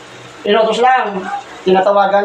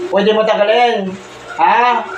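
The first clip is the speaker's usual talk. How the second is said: ordinary delivery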